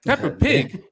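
high-pitched disbelieving question; incredulous echo. Real, not acted. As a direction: throw it back in high, offended disbelief. Indignant echo of listener's tease.

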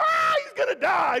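high, loud mock panic. Son panicking at the sink, a high mock wail. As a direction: wail it like a scared kid. Family panicking over dying dad.

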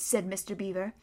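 plain reading voice

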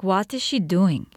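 ordinary talk, nothing marked

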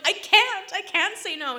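high loud I can't say no; loud pleading. Retold or imitated. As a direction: cry it out to the stylist, helpless. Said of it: "I'm like".

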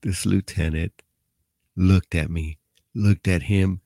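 ordinary talk, nothing marked

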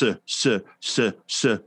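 repeated s, hissed and drawn out. Shown as a demonstration. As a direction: hiss the s sound several times. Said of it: "you're still gonna hear the s".

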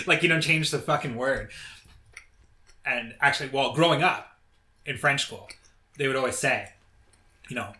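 normal talking voice

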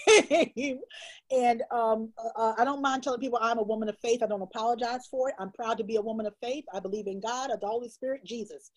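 usual speaking voice

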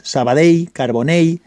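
Sabadell and Carbonell ending like "ei"; Valencian final ll. Shown as a demonstration. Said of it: "E I, si queréis".

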